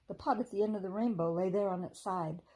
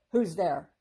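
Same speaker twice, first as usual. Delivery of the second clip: a touch of a loud, stern frog croak; 9 dB louder than usual. A narrator's reading. Frog guard challenging the newcomers, mildly stern croak. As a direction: bark it out like a grumpy guard frog. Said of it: "croaked a stern voice".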